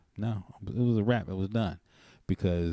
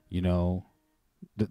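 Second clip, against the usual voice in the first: normal talking voice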